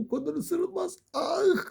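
very high squealed protest; squealed scolding. Real, not acted. Owner scolding a biting pet, high squeal. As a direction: squeal it out as if nipped.

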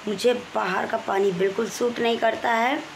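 usual speaking voice

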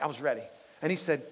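ordinary delivery

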